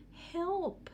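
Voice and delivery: small, high tearful "Help!"; a lost child sniffling for help; mild mock sniffle; sniffling cry for help. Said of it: "she sniffed".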